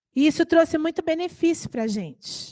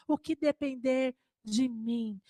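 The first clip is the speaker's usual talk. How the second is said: quiet echo; 8 dB quieter, slower than usual. Real, not acted.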